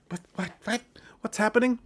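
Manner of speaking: hammed-up confusion; confused waking. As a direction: wake up bewildered and squeaky. Juliet waking from drug stupor.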